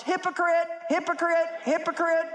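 high mock taunt of the accusing world; mocking world's yell. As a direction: jeer it like a crowd calling names. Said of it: "And the world yells".

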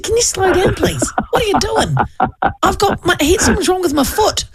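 high, strained mock complaint, half laughing; breathless high plea. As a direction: squeal it like you can't keep up. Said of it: "I'm running behind him".

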